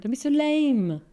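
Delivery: high, chiding push; scolding inner voice. Retold or imitated. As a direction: goad yourself like a nagging inner voice. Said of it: "quite harsh voice in my head".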